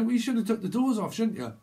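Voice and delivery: high, teasing reproach; mock reproach. Speaker teasing a mover about the doors, high and mocking. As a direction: tease them about the doors. Repeated quote, higher.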